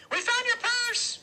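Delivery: very high, loud little voice; Freak blurting out. A narrator's reading. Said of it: "Freak blurts out".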